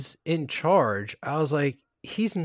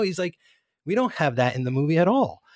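ordinary talk

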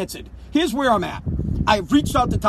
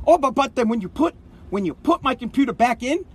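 ordinary talk

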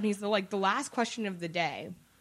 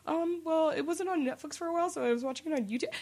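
higher, softer mock-sheepish explaining; defensive hedging; 6 semitones higher, 4 dB quieter than usual. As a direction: imitate yourself nervously justifying a hobby. Defensive explanation to friends, retold.

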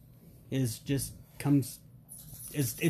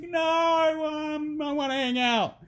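playful pestering; 13 semitones higher, slower than usual. Retold or imitated. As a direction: whine it like a bored kid wanting company. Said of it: "I'm like".

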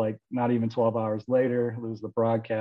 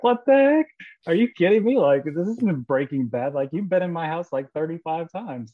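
high, loud incredulous retort; outraged disbelief; 7 semitones higher, 4 dB louder than usual. Retold or imitated. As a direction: retell it as if still can't believe the accusation. Accused of meth in house.

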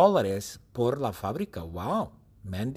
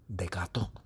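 soft whisper; 6 semitones lower, 9 dB quieter than usual. Shown as a demonstration. Teacher whispers the secret costume to the class.

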